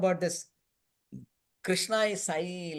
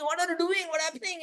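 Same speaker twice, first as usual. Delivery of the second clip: mock scream; 11 semitones higher, faster than usual. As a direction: squeal it like someone just bitten on the ear. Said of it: "she's screaming and screaming".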